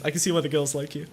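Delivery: normal talking voice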